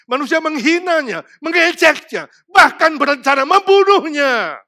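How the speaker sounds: loud, high, driving sermon climax; preaching at full force. Acted. Preacher to congregation, loud driving sermon. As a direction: hammer the gospel home from the pulpit.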